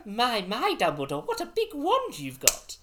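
high, laughing put-on storybook voice; mock Harry Potter character. Jokingly playing Harry to Dumbledore, hammed-up voice. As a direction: ham up wide-eyed innuendo through laughter. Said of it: "said Dumbledore to Harry".

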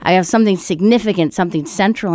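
usual speaking voice

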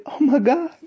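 high, loud frightened exclamation; alarmed coworker. Retold or imitated. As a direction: act out her sudden shock, loud and high. Coworker panics at the voice.

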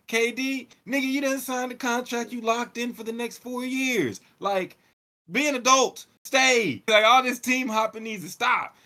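high, loud scolding aimed at KD; exasperated rant. Real, not acted. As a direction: tell him off like a fed-up big brother. Ranting straight at KD.

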